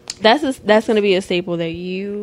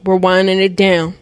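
stern announcement; 10 dB louder than usual. Retold or imitated. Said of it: "Of like".

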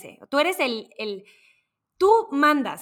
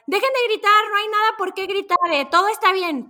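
high, loud panicked shout; pilot shouting; 8 semitones higher, 4 dB louder than usual. Retold or imitated. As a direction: yell at the passengers to stop yelling. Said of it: "el piloto empieza a gritar". (piloto gritando que todo está bien)

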